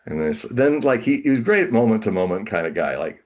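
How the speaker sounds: ordinary delivery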